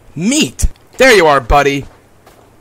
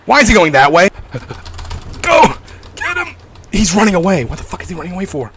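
panicked, high, loud yelling; rattled gamer losing his companion; scared horror game streamer; 5 semitones higher, 4 dB louder than usual. Real, not acted. Companion runs off, jump scare.

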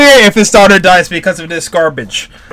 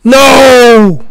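drawn-out higher cry; dismayed shout; 4 semitones higher, 4 dB louder than usual. Real, not acted. Viewer fearing for the hero, dismayed shout. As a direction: wail it out in protest.

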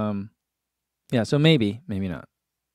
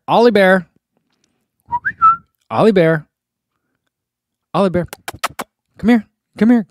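louder, higher coaxing call; calling the dog; 9 semitones higher, 8 dB louder than usual. Pretend. Host on livestream calling a viewer's dog, loud and high. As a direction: call the dog over playfully.

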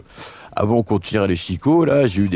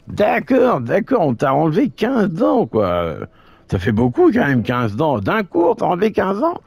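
higher teasing sidekick voice; a cheeky buddy, pitched up; Roger voice; 10 semitones higher than usual. Pretend. Roger voice reacts, incredulous.

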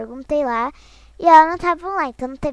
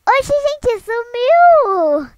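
much higher, louder astonished cry; surprised; 9 semitones higher, 9 dB louder, much slower than usual. Real, not acted. Streamer startled a player vanished, loud surprised cry. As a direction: blurt it out in disbelief.

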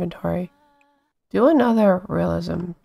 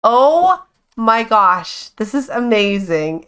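usual speaking voice

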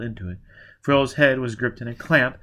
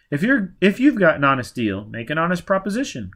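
higher-pitched, irritated challenge; heated, raised-voice demand; angry; 7 semitones higher, 6 dB louder than usual. A narrator's reading. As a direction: scold them in annoyance. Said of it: "Quat said angrily".

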